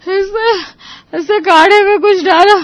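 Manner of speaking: loud gasping accusation; coughing fit. Acted. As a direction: force words out between gasps. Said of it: "उसे खांसी का दौरा पड़ गया". (खाँसते-हाँफते बोलना)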